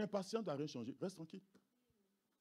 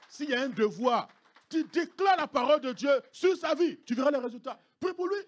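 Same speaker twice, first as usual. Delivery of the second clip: loud, higher exhortation; fiery preaching; 8 semitones higher, 11 dB louder than usual. Acted. Pastor urging parents to bless children, loud preaching. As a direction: urge the parents with full force.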